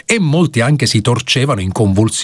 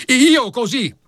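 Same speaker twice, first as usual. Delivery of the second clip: higher, jumping up to object; protesting lawyer; 11 semitones higher than usual. Acted. Young lawyer objects to the judge, higher indignant protest. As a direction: cut in, flustered and indignant. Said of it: "insorse l'avvocato difensore balzando in piedi".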